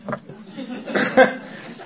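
a short, breathy laugh at his own misery; rueful laugh. Real, not acted. Telling the meeting about crying in front of a girl, short laugh. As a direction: laugh it off mid-story, unvoiced and bright.

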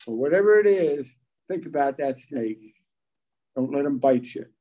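ordinary talk

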